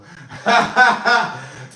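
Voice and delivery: real burst of laughter after joking; hearty laugh. Real, not acted. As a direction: crack up at your own bit. Laughs after admitting the joke.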